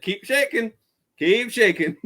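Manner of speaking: mock defiant call; retold taunt. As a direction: goad a stranger, retelling it for laughs. Retold taunt at a disapproving stranger.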